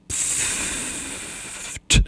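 soft hissing deflation sound; a quiet unvoiced pfft; air escaping pfft. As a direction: let the air out of a balloon. Monster deflates like a balloon.

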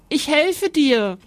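put-on a boy rushing in to rescue his father; brave cry. A narrator's reading. Boy rushes to help father.

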